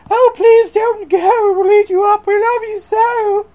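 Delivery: very high, loud falsetto monsters; hammed-up wailing plea; crying out, pleading. A narrator's reading. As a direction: plead in a silly squealing falsetto. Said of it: "But the wild things cried".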